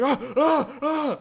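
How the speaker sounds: very high mock shrieks; imitated little-girl screams; hammed-up repeated squeals; mock girly screaming. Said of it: "He starts screaming".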